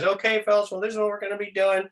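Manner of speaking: a hint of a smooth boss reading a memo; corporate manager. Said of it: "reading this corporate speak nonsense".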